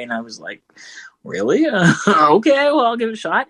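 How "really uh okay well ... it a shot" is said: higher-pitched, laughing disbelief, then agreeing; surprised, tentative acceptance. Retold or imitated. Answering Rick's offer to narrate Toy Story, surprised and hesitant. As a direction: retell being caught off guard, with a laugh.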